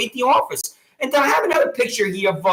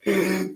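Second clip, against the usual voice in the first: real short laugh; 4 semitones lower, 6 dB quieter than usual. Real, not acted. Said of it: "I'm trying not to laugh".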